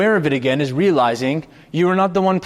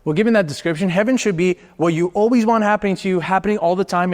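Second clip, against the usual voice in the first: usual speaking voice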